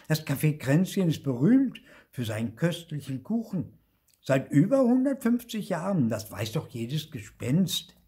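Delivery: high, emphatic show-off; know-it-all ghost. Acted. Marti explains the famous café, know-it-all. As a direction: lecture eagerly in a high voice.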